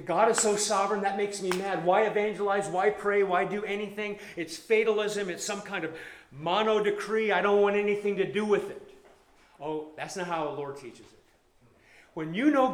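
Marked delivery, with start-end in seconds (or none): 0.0-8.9 indignant objector. Acted. Voicing objector to sovereignty.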